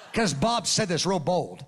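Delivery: normal talking voice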